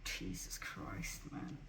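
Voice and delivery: breathless whispered curse. Real, not acted. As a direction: exhale it under your breath. Exhausted aside after angry rant.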